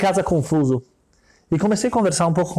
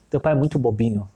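rich man's voice; 7 semitones lower than usual. Retold or imitated. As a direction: brush off the kid's father from down low. Rich man mocks the father.

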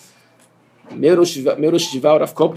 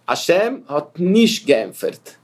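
slow demo; much slower than usual. Shown as a demonstration. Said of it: "Assim que é o Yiddish".

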